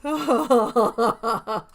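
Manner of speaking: loud laughter running into the words; laughing. Real, not acted. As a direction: laugh before you speak. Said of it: "Sorry, I'm laughing".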